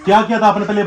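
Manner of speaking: loud firm question; stern scolding. Real, not acted. Father loudly demands what his daughter did, stern. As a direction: press her for an answer.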